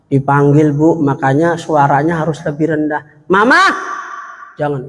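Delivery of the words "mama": calling out. Acted. Husband calling wife.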